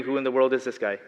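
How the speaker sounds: ordinary talk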